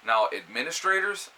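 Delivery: ordinary delivery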